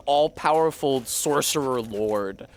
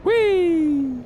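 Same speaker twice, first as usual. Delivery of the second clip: high, loud, delighted whee; real joyful squeal; gleeful whee; 13 semitones higher, 5 dB louder than usual. Real, not acted. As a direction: squeal it like a ride drop. Reacting to game on screen.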